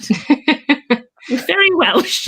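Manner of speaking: laughing. Real, not acted. Laughing while describing the comedian.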